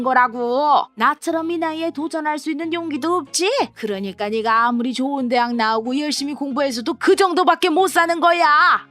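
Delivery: put-on self-righteous stepmother talking down to a young woman; scolding stepmother. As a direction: lecture her like an indignant stepmother.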